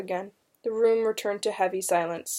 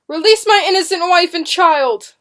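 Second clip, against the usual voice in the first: high, loud, desperate plea; desperate cry; 9 semitones higher, 10 dB louder than usual. A narrator's reading. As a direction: beg for your family at full voice. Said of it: "William Good cried desperately".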